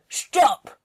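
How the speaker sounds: short loud order; sharp command. Acted. Ordering the cow loudly to stop. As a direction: snap it at the cow.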